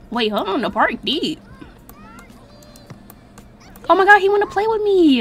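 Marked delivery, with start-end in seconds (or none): 3.9-5.2 high, loud, quick excitement; delighted gushing. Real, not acted. Player thrilled the little one wants to play, gushing. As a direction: gush with sudden delight.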